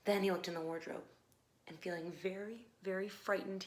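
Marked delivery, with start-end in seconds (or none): none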